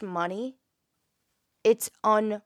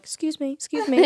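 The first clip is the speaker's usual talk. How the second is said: higher-pitched polite little voice; mock timid pleading; 7 semitones higher, 4 dB louder than usual. Nurse tech among all the doctors, meekly imitated pleading. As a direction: play yourself squeezing meekly past superiors. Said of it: "I'm like".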